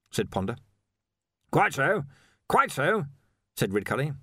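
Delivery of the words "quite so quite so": higher, controlled agreement; careful, fixed grin. A narrator's reading. Arch-Chancellor humours Ponder about the hat, carefully controlled. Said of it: "slowly and carefully through his fixed grin".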